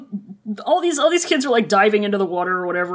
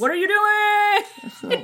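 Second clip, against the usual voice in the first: higher, slow, drawn-out scold; mock scolding; 10 semitones higher, slower than usual. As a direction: stretch it out like a nagging sibling. Voicing Jiang Cheng's scolding.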